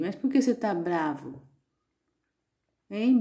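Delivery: ordinary talk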